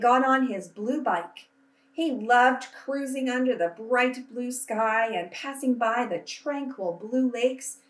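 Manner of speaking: put-on reading a picture book aloud to children, unhurried; storytelling voice. A narrator's reading. Teacher reading to her class, gentle storytelling voice. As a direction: paint each colourful list for young listeners.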